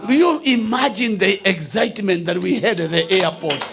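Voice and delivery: ordinary talk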